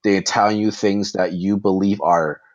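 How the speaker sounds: usual speaking voice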